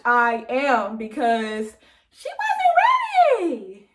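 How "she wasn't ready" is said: high, drawn-out playful exclamation; mock shock.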